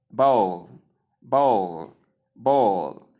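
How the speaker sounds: "bowl" with the O diphthong then L; "bowl" rhyming with "soul", one falling beat; correct bowl. Shown as a demonstration. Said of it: "This is the right pronunciation".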